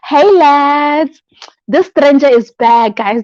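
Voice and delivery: comic returning-stranger greeting; jokey greeting. Acted. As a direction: hail the chat like old friends. Jokey greeting reading chat.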